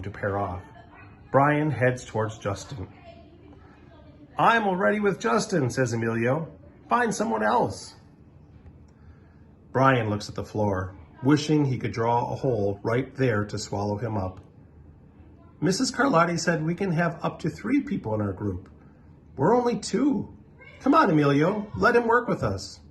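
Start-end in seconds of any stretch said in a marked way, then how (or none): 4.4-7.9 put-on higher, curt kid voice; brushing off an unwanted partner; dismissive kid. Emilio shutting Brian out. 15.6-22.8 put-on much higher, pleading kid voice; coaxing a friend to include Brian; persuading kid. Justin persuades Emilio.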